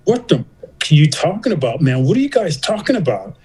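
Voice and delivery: high-pitched, baffled retold outburst; exasperated disbelief. Patient to doctor friend about his shock, exasperated disbelief. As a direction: demand an explanation, pitch jumping up.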